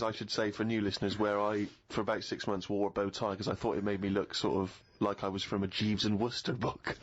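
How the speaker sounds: ordinary talk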